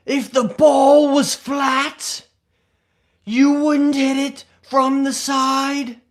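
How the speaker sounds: loud, high, drawn-out impression of a golf video guy; [name]'s video guy impression. Streamer mimics a golf video maker's speaking style, exaggerated loud slow high impression. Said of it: "videos where he's like".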